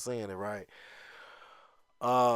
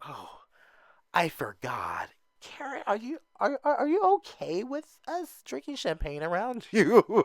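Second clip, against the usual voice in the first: fake dingy girl; 8 semitones higher than usual. Mimicking the newbie asking Karen about champagne, high and airy. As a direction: ham up the fake innocent concern. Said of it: "the fake dingy girls".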